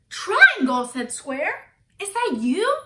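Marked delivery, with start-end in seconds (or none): 0.1-0.9 high, loud call of recognition; startled call. A narrator's reading. Square calls out to Triangle. 2.0-2.8 high, louder rising question; surprised question. A narrator's reading. Square questions Triangle at door.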